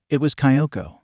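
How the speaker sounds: ordinary delivery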